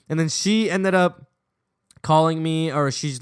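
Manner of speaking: plain reading voice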